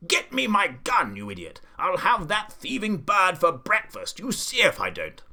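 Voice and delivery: high, loud, blustering threat; Duke's furious shouting. A narrator's reading. Duke to gardener about thieving bird, furious shout. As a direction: rage loudly at a useless underling. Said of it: "the Duke was shouting to the gardener".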